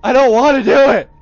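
loud rattled protest. Real, not acted. After jump scare.

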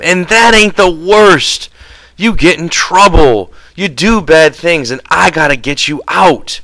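high-pitched angry tirade, bursts of shouting; furious. A narrator's reading. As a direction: lay into your companion with accusations. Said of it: "George went on furiously".

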